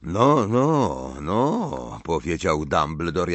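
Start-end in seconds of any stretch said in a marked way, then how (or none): 0.0-2.0 mild teacherly approval; indulgent praise. A narrator's reading. Dumbledore reacting as if shown ambitious homework, indulgent praise. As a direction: admire it like a pupil's ambitious homework. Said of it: "jakby Malfoy pokazywał mu jakąś ambitną pracę domową".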